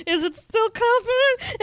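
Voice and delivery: high, slow, hammed-up worry; mock worried. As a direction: imitate a panicky self-check in a high voice. Voicing her worry while playing clarinet.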